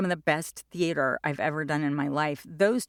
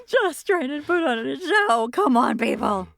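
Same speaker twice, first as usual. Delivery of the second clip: higher, louder exasperated theatre director; hammed-up plea to a lazy cast; frustrated director impression; 8 semitones higher, 5 dB louder than usual. Mimics frustrated Peter Quince.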